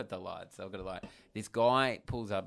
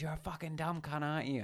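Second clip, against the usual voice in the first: imitated rant; 6 semitones higher, 4 dB quieter than usual. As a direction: mimic a ranting driver, held back. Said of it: "still just ranting at me".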